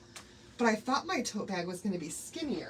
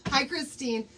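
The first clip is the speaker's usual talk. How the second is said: normal talking voice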